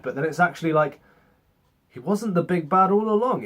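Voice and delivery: ordinary talk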